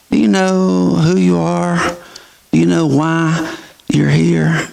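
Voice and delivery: ordinary talk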